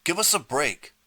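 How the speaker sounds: high, exasperated plea; pleading. A narrator's reading. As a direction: beg him to quit the joke. Pleading with Artie to stop.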